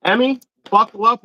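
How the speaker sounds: high, loud excited announcement; calling out. Real, not acted. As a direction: call out the big news to someone nearby. Calls out news to Emmy.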